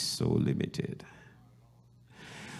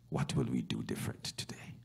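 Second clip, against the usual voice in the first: softer whispered repeat of the question; 7 semitones lower, 8 dB quieter, faster than usual. Acted. Pastor asking the church again, whispering. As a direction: pull them in with a hush.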